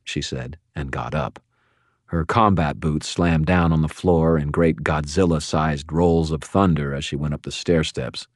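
ordinary delivery

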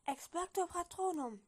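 read-aloud casting shout, moderately strong; shouted spell. A narrator's reading. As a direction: cry out the spell, pitch lifted. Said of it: "und schrie".

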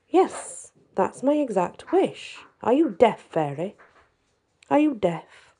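put-on high, loud and rude, a spoiled boy shouting at the fairy; bratty child yelling. A narrator's reading. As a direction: snap at her like a furious spoiled kid.

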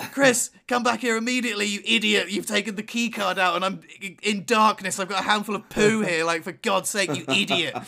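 loud, high exasperated scolding; angry phone rant. Retold or imitated. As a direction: tell off your partner down the phone. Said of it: "Grabbing the phone and being like".

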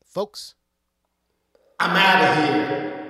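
sudden blast of a shout; jump-scare yell to startle listeners; startling boo. Pretend. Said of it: "Did I scare you?".

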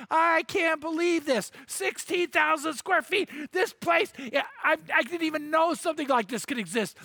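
high giddy shouting; jumping for joy, voice up; overjoyed. Retold or imitated. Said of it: "he's running around the balcony going".

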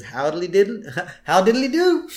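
very high, chirpy neighbor greeting; sing-song cheer; Flanders' voice. Retold or imitated. Flanders answering Homer's call, high and chirpy. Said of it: "Flanders answers the phone and says".

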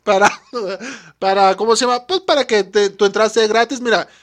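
pretend host. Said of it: "porque me imagino, mira, tú llegas acá".

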